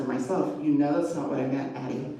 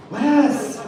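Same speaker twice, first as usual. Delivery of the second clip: louder and higher, a frustrated call of his name; exasperated groan; 7 semitones higher, 6 dB louder than usual. A narrator's reading. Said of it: "I groaned his name".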